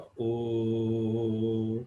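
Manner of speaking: a long, steady ओ held out; demonstrating the ओ sound; sustained ओ from the chest. Shown as a demonstration. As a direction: let ओ ring in the chest. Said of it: "यहाँ पे आपको feel होएगा".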